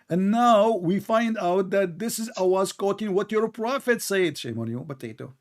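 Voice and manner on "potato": normal talking voice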